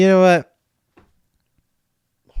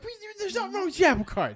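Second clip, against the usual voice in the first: high, very fast protest; frantic re-enacted arguing with mom; retold exasperation; much faster than usual. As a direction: race through it in mock exasperation, pitch way up. Arguing with mom about scam, retold.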